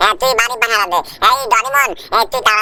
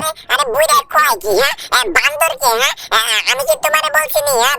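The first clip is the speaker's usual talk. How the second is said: put-on very high, bratty child voice; squeaky little brat talking back; cheeky cartoon kid. Cheeky kid snapping at grandpa, high squeaky voice.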